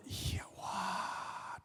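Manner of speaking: long airy Yahweh released on the breath; breathed outward. Shown as a demonstration. Preacher teaching the congregation, name breathed in and out. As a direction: breathe the name in, then exhale it. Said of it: "and then you outward".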